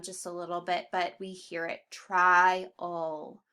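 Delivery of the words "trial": TRIAL with a long, strong I, "TRY-ul"; accented I sound. Shown as a demonstration. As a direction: stretch and stress TRY, then a weaker ul in TRIAL. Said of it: "that I sound is accented".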